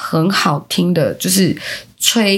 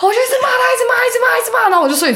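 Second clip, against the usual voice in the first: excited; much faster than usual. Real, not acted. Laughing, animated retelling.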